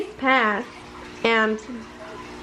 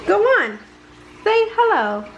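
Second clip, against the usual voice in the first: higher, coaxing mother's voice; 8 semitones higher, faster than usual. Acted. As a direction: nudge the shy fawn to greet her. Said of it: "Mother told him".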